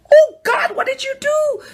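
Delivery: high, loud horrified cry at herself. Retold or imitated. Voter regretting her button press, high horrified cry. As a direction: gasp out the regret in shock. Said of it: "I went".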